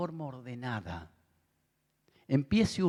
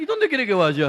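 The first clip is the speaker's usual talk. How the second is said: pastor's retort; 9 semitones higher, 10 dB louder than usual. Retold or imitated. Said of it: "Y el pastor le dice".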